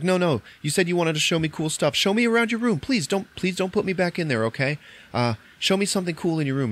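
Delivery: urgent high-pitched acted plea; pleading. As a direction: beg to stay, lightly dramatized. Retold captive pleads not to go back.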